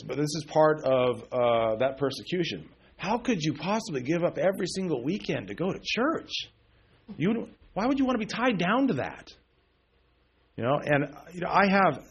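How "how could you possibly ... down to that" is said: high-pitched mock disbelief, swooping up and down; scandalized questioning, pitched well up; incredulous critics. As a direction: imitate people baffled by churchgoing. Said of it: "I've had people tell me".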